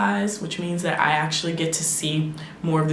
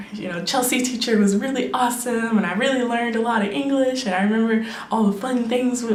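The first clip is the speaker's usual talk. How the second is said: higher, brighter-voiced imagined happy student; student's voice; 6 semitones higher than usual. Former student fondly praising teacher Chelsea, higher and excited. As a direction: gush like a kid remembering a favourite teacher. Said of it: "I want them to look back and think".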